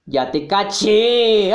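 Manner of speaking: slow, shouted gotcha; unhinged villain. Acted. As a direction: drag out the catch with menace. Villain catches his victim.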